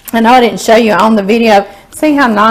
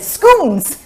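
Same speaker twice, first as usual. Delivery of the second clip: Scottish scones; 8 semitones higher than usual. Retold or imitated. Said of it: "Scottish bus driver ... he said".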